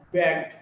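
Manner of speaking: bagged ending in a voiced D. Shown as a demonstration. Teacher showing a past ending, bagged with final D. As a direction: say bagged as one syllable closed by D. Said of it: "D or E D is pronounced as D".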